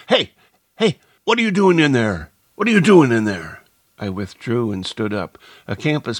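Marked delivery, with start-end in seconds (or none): 0.1-3.6 loud, high challenging shout. A narrator's reading. Man shouts from the other side of the hedgerow, shouting. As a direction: shout across a hedge at an intruder. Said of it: "A man's voice shouted from the other side".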